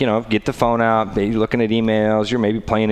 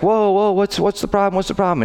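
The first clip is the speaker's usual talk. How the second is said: put-on higher, defensive drive-thru girl; imitating flustered worker; 10 semitones higher than usual. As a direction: play innocent, caught off guard. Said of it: "the girl's like".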